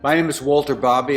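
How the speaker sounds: ordinary talk, nothing marked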